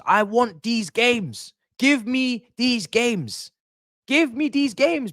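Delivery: slow, high pleading; stretched-out yearning cry; craving. Real, not acted. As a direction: beg for the big games. Pleading for big games.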